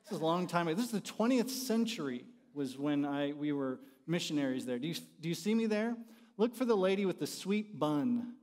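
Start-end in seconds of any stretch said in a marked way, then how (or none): none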